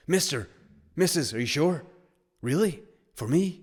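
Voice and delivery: put-on high-pitched kid in happy disbelief; amazed kid. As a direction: ask as if you can't believe your luck. Acting an amazed kid given money.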